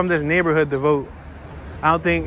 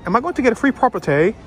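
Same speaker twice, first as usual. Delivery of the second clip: put-on British accent; 6 semitones higher, faster than usual. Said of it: "British accent".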